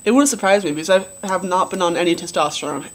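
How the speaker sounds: usual speaking voice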